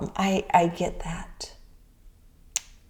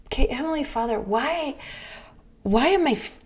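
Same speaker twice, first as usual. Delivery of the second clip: higher, softer, pleading prayer; retold exasperated prayer; 5 semitones higher, 4 dB quieter than usual. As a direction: act out a frustrated plea to God. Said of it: "I go to say my morning prayers".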